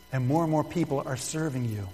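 usual speaking voice

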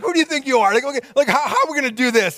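high incredulous snap; mock sarcastic disciples; snappy retort. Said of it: "snappy answers to stupid questions".

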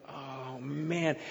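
soft, sinking groan of dismay; quiet dismay. Retold or imitated. Dad seeing his bleeding daughter, hushed dismay. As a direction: mutter it under your breath, stricken.

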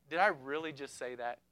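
embarrassed inner voice; Peter's inner thought. Retold or imitated. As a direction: mutter it to yourself in dismay. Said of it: "Peter's thoughts".

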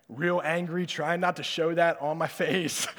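ordinary talk

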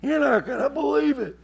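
put-on high, loud drunk-style cackle; laugh, then crow it in disbelief; laughing heckler. Drinking guy reacts to preacher's rebuke, loud high laughing. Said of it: "looked at me and went".

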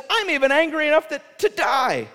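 high, loud petulant rant; angry outburst. Acted. Jonah snapping back at God, a high loud angry rant. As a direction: rant like a sulking child. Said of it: "And Jonah says".

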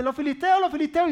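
high, loud cry of warning; urgent high-pitched yelling; alarmed shout. Acted. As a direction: shout out that enemies are coming. Delilah raises the alarm again.

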